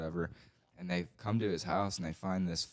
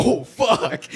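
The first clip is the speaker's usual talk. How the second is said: laughing panic; 13 dB louder than usual. Pretend. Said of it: "we would've been like".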